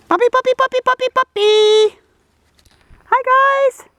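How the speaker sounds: very high, loud sing-song puppy call; calling the puppies. Real, not acted. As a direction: call them over like an excited dog owner.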